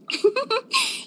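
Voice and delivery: light giggle rising at the end; giggle, a little embarrassed; bashful giggle. Acted. Healer caught swishing her tail, bashful giggle.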